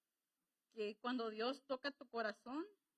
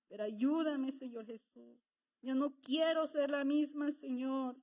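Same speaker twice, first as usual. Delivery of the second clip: louder, pleading prayer voice; fervent prayer; 5 dB louder than usual. Acted. Preacher showing the congregation how to pray, fervent. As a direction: pour your heart out to God.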